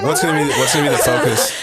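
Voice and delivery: laughing through the question. Real, not acted. Host cracking up mid-question.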